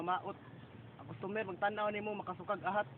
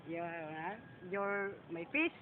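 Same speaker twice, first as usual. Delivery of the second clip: ordinary talk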